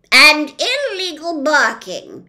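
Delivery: loud, slowish officious policeman; pompous policeman. Acted. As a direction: lay down the law with bluster. Policeman adds charge.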